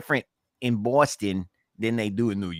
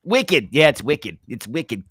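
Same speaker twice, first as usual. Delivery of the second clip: Boston accent; 3 semitones higher, 5 dB louder, slower than usual. Shown as a demonstration. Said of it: "word that they say in Boston".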